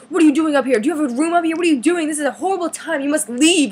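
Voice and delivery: high, loud mock-panicked scolding; hammed-up frantic security guard, high and loud; freaking out. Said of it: "started freaking out".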